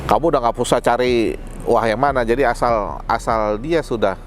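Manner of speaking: ordinary talk, nothing marked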